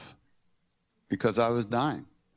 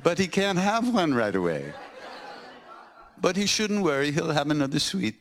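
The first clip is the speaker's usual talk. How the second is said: laughing retelling; 7 semitones higher than usual. Real, not acted. Retelling teasing remark, laughing.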